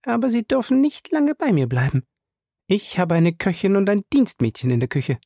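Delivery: high woman's voice with a pointed warning; lightly significant caution; meaningful. A narrator's reading. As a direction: add it pointedly, pitched high. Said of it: "fügte sie bedeutungsvoll hinzu".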